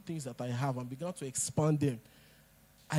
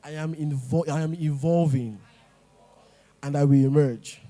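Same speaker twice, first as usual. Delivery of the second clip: loud firm declaration; repeat the declaration; 2 semitones higher, 5 dB louder than usual. Shown as a demonstration. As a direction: declare it again, with conviction. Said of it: "I want you to say it again".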